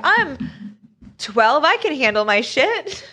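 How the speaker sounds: high-pitched mock bravado; cocky preteen. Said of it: "but I was like".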